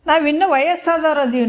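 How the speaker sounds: high-pitched acted objection; protesting refusal. Children refuse to die for their father, mock protest. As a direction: imitate children begging off, pitched well up.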